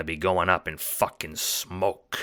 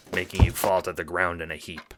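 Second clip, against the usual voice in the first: ordinary delivery, nothing marked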